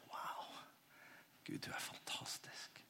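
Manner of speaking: very soft, whispered wonder; awed whisper. Acted. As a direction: whisper it as a private word of awe to God. Waking in awe before God. (ærbødig, forundret hvisking)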